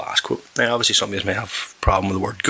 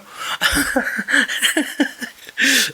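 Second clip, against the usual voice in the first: big laugh. Real, not acted. Laughing at the softball story.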